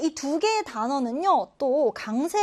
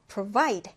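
provide with the weight on VIDE, pro-VIDE; stress on provide; 3 semitones lower than usual. Shown as a demonstration. Teacher modelling the stress on the second syllable of provide. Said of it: "두 번째 음절의 강세를 두고요".

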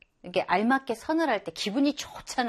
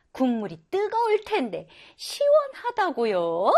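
put-on high, puzzled question of a surprised girl; 6 semitones higher than usual. Teacher voicing a foreign girl amazed that hot soup is cool, surprised. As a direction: raise the pitch as a girl shocked by the word. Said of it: "라고 이제 놀라워하죠".

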